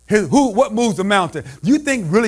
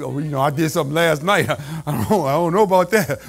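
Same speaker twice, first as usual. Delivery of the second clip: lower, laughing embarrassed confession; mock sheepish churchgoer; 9 semitones lower, 4 dB quieter than usual. Preacher mimicking a shy member, laughing sheepishly. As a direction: play the shy member squirming.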